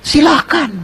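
high-pitched, gracious go-ahead; obliging horse owner. Acted. Horse owner letting his friend ride, obliging.

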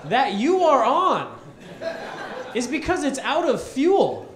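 1 incredulous retort. Retold or imitated. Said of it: "I was like".

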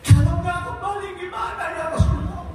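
fervent sermon chant; preaching at full force. Acted. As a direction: chant it out to the congregation.

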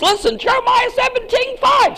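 preaching shout. Real, not acted. Preacher calling the congregation to a scripture, shouting. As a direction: shout it out to grab the room.

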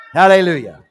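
ordinary talk

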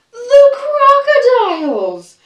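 high, loud drawn-out shriek of alarm; scared shout. Acted. The monkeys spotting the crocodiles, loud scared shout. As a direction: cry it out high, slow and terrified. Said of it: "Five little monkeys all shout".

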